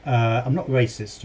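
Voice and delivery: normal talking voice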